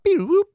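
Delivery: high R2-D2 style beep and whoop; robot beeps. Retold or imitated. Joking that his co-pilot is like R2-D2, high droid beeps. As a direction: squeak like a little droid.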